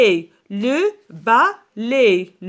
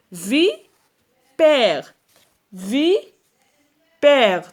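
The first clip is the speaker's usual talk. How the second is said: loud vi... père with long gap; syllable-by-syllable; 3 semitones higher, 4 dB louder, much slower than usual. Shown as a demonstration. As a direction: hold each half of vipère separately. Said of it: "فين كنسمعوا le son A".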